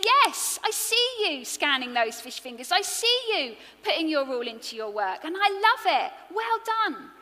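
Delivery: high, loud, emphatic praise; delighted affirmation. Acted. God applauding a shop worker's work, delighted affirmation. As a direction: cheer them on, punching the key words.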